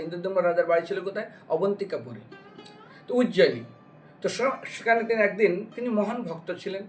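ordinary delivery

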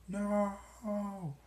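high, loud cry of no; desperate yell. Retold or imitated. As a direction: scream it as you watch her get stabbed. Barry yells as Iris is stabbed.

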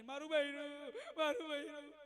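loud mock wail for his wife; wailing cry. Widower wailing for his dead wife, loud mock sobbing. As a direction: howl it like a grieving husband. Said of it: "બૂમો પાડી પાડી".

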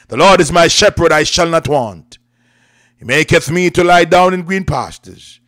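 usual speaking voice

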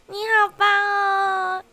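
ordinary delivery

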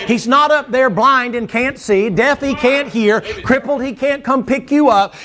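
usual speaking voice

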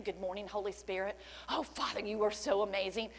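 normal talking voice

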